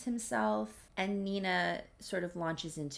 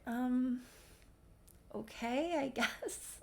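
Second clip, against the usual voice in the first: higher, hesitant reluctant reply; unsure, tentative acceptance; hesitant agreement; 5 semitones higher, 4 dB quieter, slower than usual. Retold or imitated. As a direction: agree doubtfully, with long pauses. Acted reluctant acceptance of invitation.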